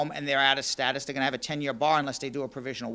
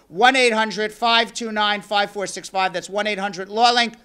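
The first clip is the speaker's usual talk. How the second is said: high, loud radio host announcement; rally callers to the lines; lively announcer voice; 7 semitones higher, 9 dB louder than usual. Acted. Host announces phone number.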